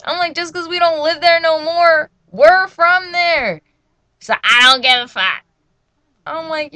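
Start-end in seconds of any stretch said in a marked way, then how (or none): 0.4-3.6 high, loud retold arguing; loud, exasperated, slowing on the last words; indignant protest. 4.2-5.4 loud, retold dismissive snap; very loud, fed-up comeback; mom's angry retort.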